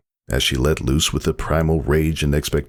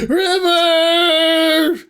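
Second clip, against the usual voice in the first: long high full scream of a name; 5 dB louder than usual. Acted. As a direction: cry out his name at the peak of labour. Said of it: "She screamed the name of her love".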